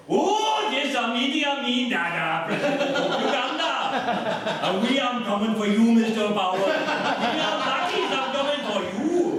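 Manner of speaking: loud, high hammed-up East African accent, laughing through it; mock-menacing African dictator voice, loud and high; Idi Amin impression. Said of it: "two impressions".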